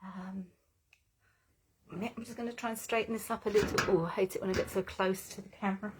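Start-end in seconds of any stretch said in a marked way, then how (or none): none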